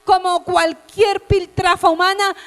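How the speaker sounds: loud, high sermon cry breaking into tears; tearful preaching. Acted. Preacher pleading with the broken-hearted, crying out loudly. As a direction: shout it, voice near weeping. Climax of altar call.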